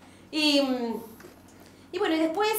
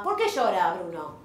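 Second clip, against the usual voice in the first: lower, slow mock father; imitating the father; 8 semitones lower than usual. The father asking why Bruno cries, low and slow. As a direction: put on a dull dad voice. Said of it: "hasta el padre me preguntaba".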